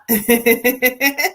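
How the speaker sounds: light, rapid chuckle; relayed laugh. Retold or imitated. Reader passing on a spirit's teasing, laughing. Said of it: "She laughs and she says".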